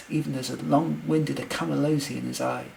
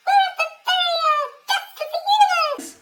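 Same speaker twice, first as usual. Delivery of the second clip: extremely high, squeaky voice; excited high-pitched eep; eeping nurse; 12 dB louder than usual. A narrator's reading. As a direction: squeal it loudly like a tiny creature. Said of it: "eeped the nurse".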